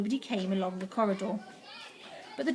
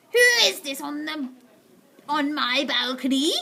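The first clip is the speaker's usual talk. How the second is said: a hint of a witch muttering, slower; muttered witch line; 5 semitones higher, 5 dB louder than usual. A narrator's reading. Witch on the balcony, muttering suspiciously. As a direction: witch grumbling to herself, half-voiced. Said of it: "she muttered".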